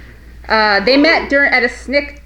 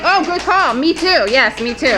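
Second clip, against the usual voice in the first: mock eager audience member; 7 semitones higher than usual. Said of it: "You're like".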